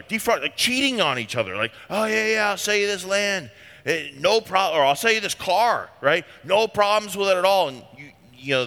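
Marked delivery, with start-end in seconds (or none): 1.9-5.9 higher, breezy salesman pitch; smooth-talking swindler. Retold or imitated. Church swindler to fellow believer, breezy fake assurance. Swindler voiced selling a car. 6.4-7.7 higher, breezy salesman pitch; smooth-talking swindler. Retold or imitated. Church swindler to fellow believer, breezy fake assurance.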